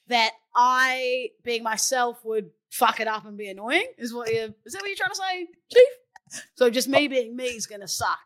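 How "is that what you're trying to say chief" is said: mock hurt.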